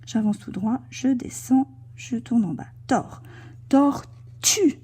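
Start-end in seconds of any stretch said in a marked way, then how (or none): 3.7-4.8 "tortue" split in two, "tue" set apart; syllable demonstration. Shown as a demonstration. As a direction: break "tortue" after the R and stress "tue". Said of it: "J'entends le T et le U".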